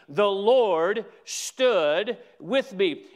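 slow, deliberate proclamation; stretched-out, weighty verse; emphatic scripture quote. Acted. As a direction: declare it word by word.

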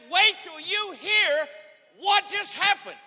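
high, slow shouted wail; mock bawling. Preacher bawling the news to his friend, imitated wail. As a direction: imitate wailing through tears, drawn out and loud. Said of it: "I'm bawling my eyes out".

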